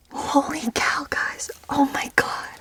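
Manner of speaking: awestruck whispered exclamation; excited whisper. Real, not acted. Camper spotting the aurora at night, excited whisper. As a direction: gasp it out in a whisper. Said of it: "I'm so excited".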